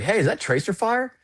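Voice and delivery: sudden high alarmed question; startled question. Retold or imitated. Crewman spotting tracer fire from the gunship, high and startled. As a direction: spot something alarming and call it out.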